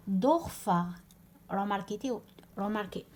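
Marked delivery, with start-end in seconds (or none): 0.1-0.8 loud دورفر with no umlaut on the o; plain o, no umlaut. Shown as a demonstration. Teacher shows the word before adding umlaut, دورفر with plain o. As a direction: say دورفر with back o, as Dorfar. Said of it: "قبل ما نزيد الاملاوت".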